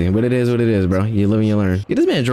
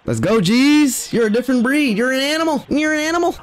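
rising excitement, pitched way up; hyped celebration; 11 semitones higher than usual. Real, not acted. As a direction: cheer your teammate on in a high, excited voice. Celebrating a play in the game.

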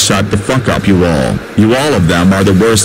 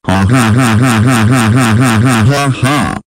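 evil laugh; 2 semitones higher than usual. Acted. Gleeful laugh before drinking beer.

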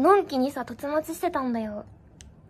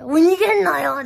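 louder mock thug shout; retold harasser yelling, louder; imitated yell; 3 semitones higher, 10 dB louder than usual. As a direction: call out after her like a bully, loud. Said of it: "とか言って".

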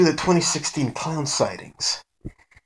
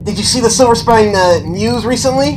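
high, loud goofy guy voice, a bit rushed; Mister Ghostface's stupid voice; 7 semitones higher, 6 dB louder, faster than usual. Retold or imitated. Friend in a mask hyping the news, high goofy voice. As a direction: pester him with silly gossip, pitched up. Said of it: "in his stupid voice".

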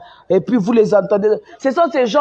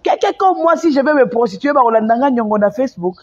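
ordinary talk, nothing marked

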